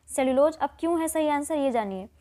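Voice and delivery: normal talking voice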